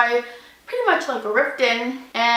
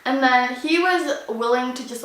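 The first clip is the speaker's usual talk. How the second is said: ordinary delivery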